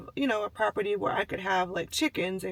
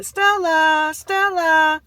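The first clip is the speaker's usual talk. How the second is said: high, loud call across the yard; mock yelling a name; 9 semitones higher, 7 dB louder, much slower than usual. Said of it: "she started yelling".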